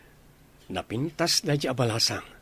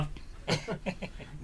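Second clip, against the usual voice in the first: breathy mocking laugh; 5 semitones higher, 10 dB quieter than usual.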